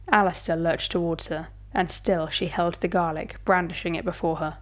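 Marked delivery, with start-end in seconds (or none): none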